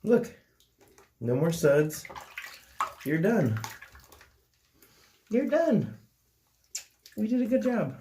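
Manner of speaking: high cooing praise to a pet. Real, not acted. Praising pet after bath.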